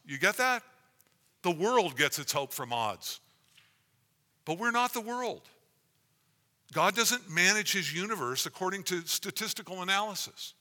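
fervent full-voiced sermon; preaching.

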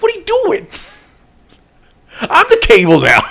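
high-pitched, loud cartoon outburst; hammed-up high yell; Rocket. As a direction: start squeaky and indignant, then shout the declaration. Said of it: "the Rocket's like".

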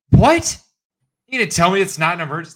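hammed-up indignation; mock outrage. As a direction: explode in disbelief. Outraged reenactment.

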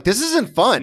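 high-pitched, exasperated protest; mock whining complaint. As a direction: whine it like a losing player. Acting out a frustrated player.

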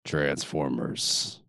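deep dramatic trailer opener; movie trailer voice. Acted. Parody trailer tagline.

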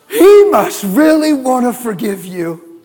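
preaching refrain. Real, not acted.

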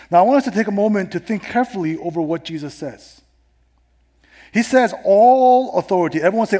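emphatic pulpit delivery, rising in force; forceful preaching. Acted. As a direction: punch out the scripture to the congregation. Preaches on a Bible verse.

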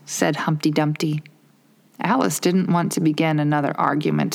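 plain reading voice

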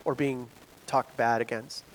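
usual speaking voice

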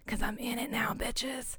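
hushed, gleeful whisper to the listeners; conspiratorial stage whisper; whispered brag. Pretend. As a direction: whisper it like a smug little secret. Aside bragging about being in it.

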